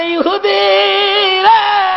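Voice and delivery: high, drawn-out cry from afar; calling out. Retold or imitated. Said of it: "নবী ডাক মারছে". (উঁচু সুরে লম্বা টানে ডাক)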